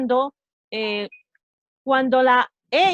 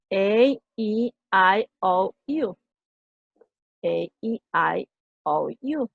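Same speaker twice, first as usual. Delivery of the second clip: slow recited A E I O U; recited vowels; 4 semitones lower, much slower than usual. Shown as a demonstration. Teacher slowly recites the vowels for students. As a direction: spell out each vowel. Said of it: "reciting vowels".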